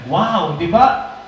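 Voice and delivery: normal talking voice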